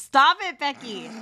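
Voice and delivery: high, loud playful telling-off; mock scolding. Real, not acted. Mock scolding co-host for accent joke.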